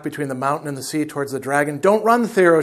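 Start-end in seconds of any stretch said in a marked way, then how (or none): 1.8-2.6 raised, higher urgent call; crying out. A narrator's reading. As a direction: cry a warning, louder and higher. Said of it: "she cried".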